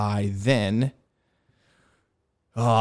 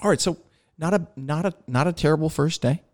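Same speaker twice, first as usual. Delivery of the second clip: higher-pitched, easygoing let-down; gentle, casual debriefer; 6 semitones higher than usual. Retold or imitated. Instructor debriefs pilot after first day, gentle and casual. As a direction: imitate an instructor breaking it gently. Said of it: "real gentle, real casual".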